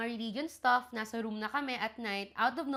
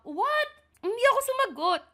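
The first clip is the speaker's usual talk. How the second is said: much higher, shocked squeak; stunned at the reply; shocked what; 10 semitones higher, 5 dB louder than usual. Real, not acted. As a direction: squeak it out in disbelief. Host shocked at story.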